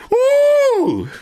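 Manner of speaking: high, loud whoop; rowdy party animal. Real, not acted. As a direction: let out a hype woo. Hyped up testing loud headphones.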